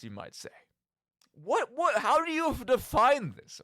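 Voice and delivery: normal talking voice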